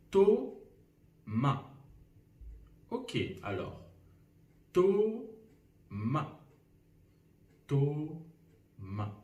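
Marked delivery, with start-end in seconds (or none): 0.1-1.7 Thomas as two slow syllables, a long pause between; split into syllables. Shown as a demonstration. Teacher counts syllables in a first name, "Thomas" slowly split. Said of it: "Combien il y a de syllabes". 4.7-9.2 Thomas in two separated beats; split into syllables. Shown as a demonstration. Teacher repeats a name to count syllables, "Thomas" slowly split. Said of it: "Combien il y a de syllabes".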